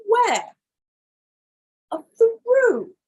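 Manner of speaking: mock warning. Dramatic warning to Australians.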